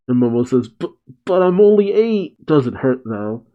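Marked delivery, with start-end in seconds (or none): 0.7-2.4 put-on high, hesitant kid voice; whining child. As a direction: mimic a little kid's pitched-up protest. Acted child protesting after being hit.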